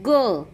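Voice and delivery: ग as an isolated hard "guh"; phonic G sound. Shown as a demonstration. Teacher showing the sound of G, ग as "guh". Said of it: "जीचा उच्चार होतो".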